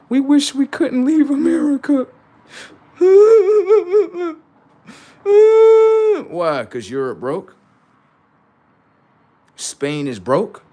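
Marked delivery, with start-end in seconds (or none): 0.1-2.1 higher, whiny mock European. Mocking European people in videos, higher whiny voice. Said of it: "videos of European people saying". 3.0-4.3 loud high laugh. Real, not acted. Laughing at his own mockery of Europeans, loud and high. 5.2-6.6 mock surprise. Mock question after laughing.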